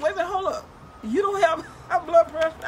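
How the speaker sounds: doctor can't believe the blood pressure reading; surprised doctor. Retold or imitated. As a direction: act out the doctor's double take.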